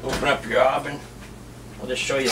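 normal talking voice

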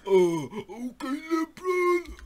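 high, loud, slow mock cheer; mock shout. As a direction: shout it like a hype man.

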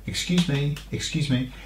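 soft, polite Excuse me, excuse me. Shown as a demonstration. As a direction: ask to get past politely.

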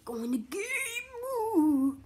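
drawn-out high announcer call; game announcer. Pretend. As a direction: stretch it like an arena announcer. Calling the game start like announcer.

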